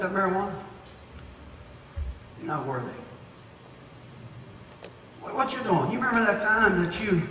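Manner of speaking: low, soft, sly accusing voice; the devil whispering. Acted. The devil whispering accusations to a believer, soft and sly. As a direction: hiss doubts into their ear like the devil. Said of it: "This is the devil talking".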